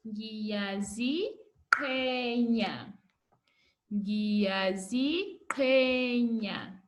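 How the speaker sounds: "Ngiyaziqhenya" drawn out very slowly, each syllable clear; slow model word. Shown as a demonstration. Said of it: "You say".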